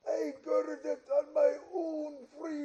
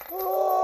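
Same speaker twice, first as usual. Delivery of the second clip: anguished cry; 6 semitones higher, 4 dB louder than usual. Acted. Ghost cries out in anguish.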